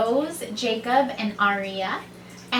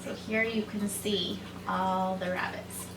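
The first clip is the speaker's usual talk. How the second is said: plain reading voice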